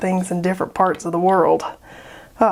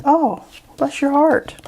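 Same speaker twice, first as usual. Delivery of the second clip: higher, slow, syrupy Southern-lady sweetness; sweet as sugar but meaning the opposite; sugary fake pity; 6 semitones higher, slower than usual. To people who don't have fun making art: slow, high, syrupy mock pity. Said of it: "I'm like".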